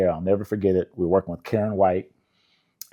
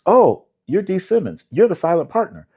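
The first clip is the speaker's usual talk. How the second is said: imitating an excited stranger; 11 semitones higher, 6 dB louder than usual. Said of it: "and some guy goes".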